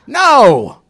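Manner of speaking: sudden loud No; loud shout. Acted. Preacher rejecting his own excuse, loud shout. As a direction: bark it out loud.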